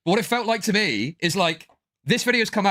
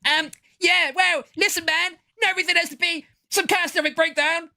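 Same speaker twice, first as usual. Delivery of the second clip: much higher, whiny mock voice; mock a rival's pathetic voice; mocking Chud; 12 semitones higher than usual. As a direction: squeak it out to belittle a rival. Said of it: "this voice thing is so pathetic".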